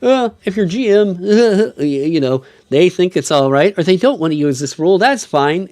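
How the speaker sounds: high, loud mocking whine; mocking rulebook voice. Mocking wishy-washy rulebook wording.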